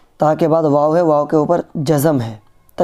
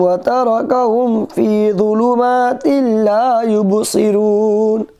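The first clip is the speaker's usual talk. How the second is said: slow melodic tajweed chant; Quran recitation; 6 semitones higher, much slower than usual. Acted.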